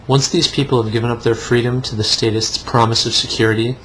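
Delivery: ordinary talk, nothing marked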